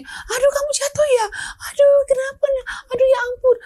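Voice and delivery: high, fussing mock panic over a fall; hammed-up fretting mother, voice jumping high; overreacting parent. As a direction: fuss like a panicked mum. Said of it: "jangan sampai nanti kita gini".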